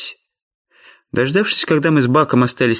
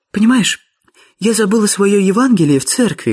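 put-on high-pitched character voice for a young lady; Miss Sophia explaining; 8 semitones higher than usual. A narrator's reading. Miss Sophia explains her forgotten Gospel to George, high voice. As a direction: explain in a lifted, girlish register. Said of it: "пояснила мисс София".

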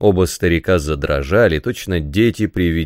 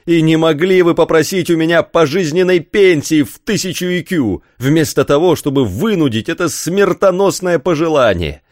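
high, raised-voice tirade; angry shouting; 8 semitones higher, 3 dB louder than usual. A narrator's reading. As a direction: rail at the old teacher in outrage. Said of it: "крикнул Рафаэль". (гневный крик)

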